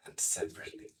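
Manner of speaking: breathy whispered afterthought; whispered aside. Real, not acted. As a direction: whisper it under your breath. Aside while mixing drink.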